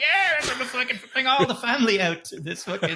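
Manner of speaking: high, laughing mock cheer; hammed-up delight of an eager townsperson; mock enthusiasm. Townsfolk bringing family to a murder festival, mock enthusiasm.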